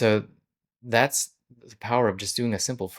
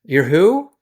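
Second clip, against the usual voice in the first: higher, louder baffled who; confused customer; 9 semitones higher, 8 dB louder, much slower than usual. Retold or imitated. Customer on the phone hearing the owner's name, higher and louder in baffled surprise. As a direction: play the startled customer who can't place the name. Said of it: "they're gonna be like".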